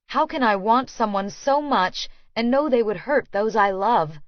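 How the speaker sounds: ordinary delivery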